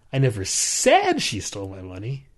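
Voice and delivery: said pushed up and held; stress on SAID. Shown as a demonstration. Demonstrating emphasis on said to a co-host. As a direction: lean on the word said. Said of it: "based on what word you put emphasis on".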